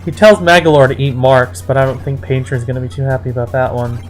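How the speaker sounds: ordinary talk, nothing marked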